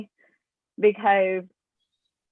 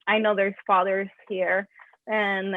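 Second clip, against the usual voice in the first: usual speaking voice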